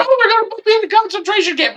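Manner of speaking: high, fast, whiny mock voice of a panicked critic; hammed-up mock panic; mocking whiner. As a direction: squeal it out like a hysterical alarmist. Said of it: "on T V and say".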